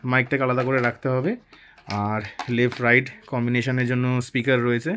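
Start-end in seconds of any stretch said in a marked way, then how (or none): none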